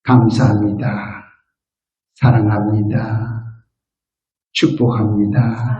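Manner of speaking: gentle blessing words. Shown as a demonstration. Pastor teaching seniors their morning words, slow and tender. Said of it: "세 마디만 하세요".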